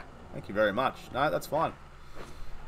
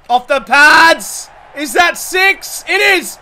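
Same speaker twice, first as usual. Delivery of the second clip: excited shout; 7 semitones higher, 8 dB louder, slower than usual. Real, not acted. Celebrating a six in game.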